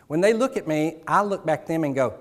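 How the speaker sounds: normal talking voice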